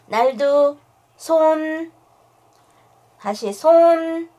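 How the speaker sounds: slow, loud, high command; firm dog commands. Real, not acted. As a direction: spell out the command slowly. (천천히 크게 하는 명령)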